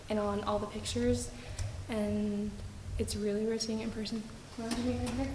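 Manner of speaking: very soft, hushed voice; whispering on the phone. Real, not acted. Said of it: "I feel like I have to whisper".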